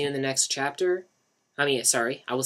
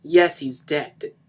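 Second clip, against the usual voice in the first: very slow, louder, sobbing; 4 semitones higher, 10 dB louder, much slower than usual. A narrator's reading. Mark confirming the death, sobbing. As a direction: drag each word out through tears. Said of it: "pushed out through tears".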